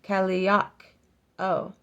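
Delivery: ordinary talk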